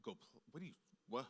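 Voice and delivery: low, soft, broken-off stammer; quietly baffled, sentences left unfinished; stunned disbelief. Retold or imitated. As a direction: imitate a dumbfounded kid lost for words. Child stunned by mother's dismissal.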